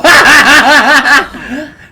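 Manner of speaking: loud burst of laughter. Real, not acted. Laughing at the Fluttershy twist.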